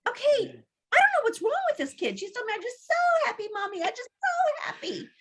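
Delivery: high, fast, exasperated; exasperated retelling. Retold or imitated. Mother on the phone to dad, high and exasperated. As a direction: pitch it up and rush, at wit's end. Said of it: "and I'm like".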